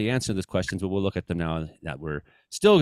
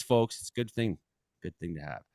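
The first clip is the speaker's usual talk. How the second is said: ordinary delivery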